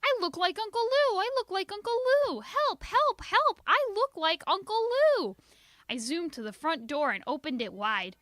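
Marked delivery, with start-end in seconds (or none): 0.0-5.4 high loud alarmed cries; girl shouting that she looks like Uncle Lou; panicked shouting. A narrator's reading. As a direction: yell for help in mock panic. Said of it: "kept running and shouting".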